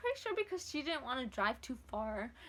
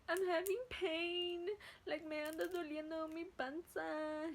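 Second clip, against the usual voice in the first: high, drawn-out complaint; mock whining through belly pain; pained whine; 5 semitones higher, slower than usual. Daughter telling mom her belly hurts, pained whine. Tells mother she is in pain.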